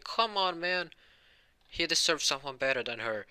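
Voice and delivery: high, exasperated appeal; pleading protest. Real, not acted. Fan argues for his favourite pairing, exasperated. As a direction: plead your case, pitch jumping up.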